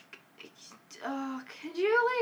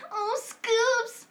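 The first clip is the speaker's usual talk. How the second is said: Scooby-Doo voice; 9 semitones higher than usual. Retold or imitated. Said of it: "a Scooby zoink".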